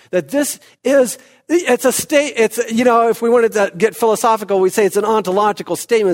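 high, loud preaching build; preaching at full force. Acted. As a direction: proclaim it to the whole church. Preaching to the congregation.

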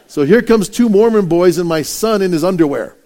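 ordinary delivery

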